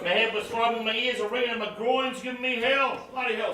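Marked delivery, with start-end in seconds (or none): none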